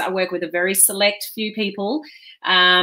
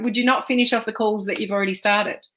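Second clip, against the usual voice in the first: ordinary talk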